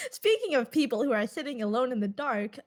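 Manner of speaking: normal talking voice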